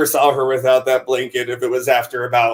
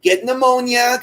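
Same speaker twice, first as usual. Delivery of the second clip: pitched-up imitation of a worried grandma; imitating fretting grandmother; 8 semitones higher than usual. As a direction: fuss over someone in a high old-lady voice. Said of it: "she was a grandmother".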